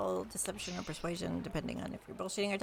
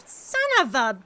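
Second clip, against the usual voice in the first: much louder, pitch shooting up on bitch; outraged yell; 13 semitones higher, 10 dB louder than usual. Acted. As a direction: bellow the curse in shock. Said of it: "see her go".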